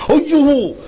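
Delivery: plain reading voice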